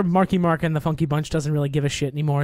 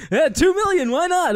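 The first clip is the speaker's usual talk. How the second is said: reckless bid; 10 semitones higher, 4 dB louder than usual. Retold or imitated. Retold bidder raising in auction.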